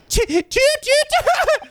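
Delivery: very high toots, drawn out very slowly; slow mouse toots. Pretend. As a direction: stretch each squeaky toot long. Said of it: "That sounds like a big mouse in trap".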